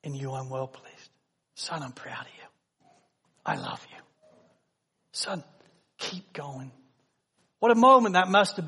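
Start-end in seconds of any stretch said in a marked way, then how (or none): 0.0-6.7 soft, hushed, near-whisper of love; whispered fatherly blessing. Acted. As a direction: whisper it like a father encouraging his son. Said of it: "to hear".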